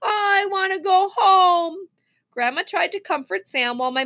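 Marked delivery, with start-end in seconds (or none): 0.0-1.9 high, slow tearful whine; drawn-out sobbing complaint, building to a wail; child crying. A narrator's reading. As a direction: wail like a hurt little boy. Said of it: "Sam cried".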